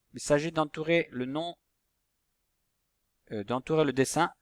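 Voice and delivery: usual speaking voice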